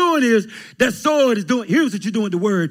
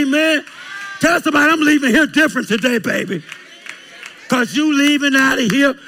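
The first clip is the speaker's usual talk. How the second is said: preaching build; 3 semitones higher than usual. Acted.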